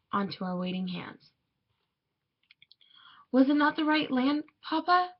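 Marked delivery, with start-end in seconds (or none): 3.3-5.1 put-on high, rising child's question; child asking father. A narrator's reading. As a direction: ask papa in a lifted, puzzled voice. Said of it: "I asked".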